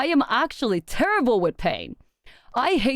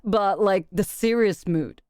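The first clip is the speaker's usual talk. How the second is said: ordinary talk, nothing marked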